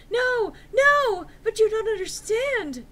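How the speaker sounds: high loud overacted pleading; whiny desperate begging voice; hammy stage actor. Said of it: "Draco pleaded".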